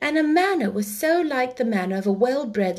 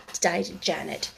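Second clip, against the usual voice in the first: plain reading voice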